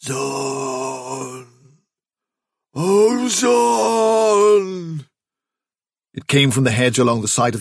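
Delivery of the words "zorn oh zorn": high, long-drawn ghostly keening; desolate, eerie rabbit cry; unearthly wailing. A narrator's reading. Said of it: "when the voice replied".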